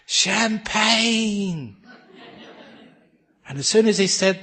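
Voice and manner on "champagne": higher pitched, gleeful shout of Champagne; joyful shout. Acted. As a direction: call it out with delight mid-slide. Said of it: "he shouted".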